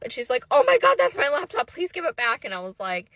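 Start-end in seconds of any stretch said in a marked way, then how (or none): 0.5-2.4 louder, higher excited outburst; urgent plea. Retold or imitated. Other woman spotting her laptop, imitated urgent plea. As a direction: blurt it out, then plead, imitating her.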